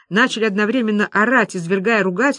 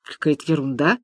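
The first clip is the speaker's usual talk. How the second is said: low, puzzled dismissal; bewildered; 5 semitones lower than usual. Acted. Businessman shrugs at the cheque, bewildered. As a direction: say it lower, lifting at the end. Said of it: "недоуменно повёл плечами бизнесмен".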